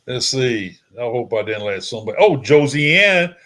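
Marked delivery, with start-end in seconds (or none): 2.2-3.3 loud, drawn-out happy hello; excited greeting. Real, not acted. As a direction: greet her loudly and gladly. Spots a viewer joining the show.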